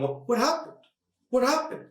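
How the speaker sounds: high, mock-confused questioning; bewildered fighter. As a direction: play knocked-out confusion in a high voice. Acts dazed fighter on the ground.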